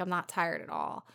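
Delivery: usual speaking voice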